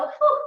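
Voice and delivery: high, loud exhausted whew; tired whew. Real, not acted. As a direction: blow out a tired breath. Exhale during ab workout.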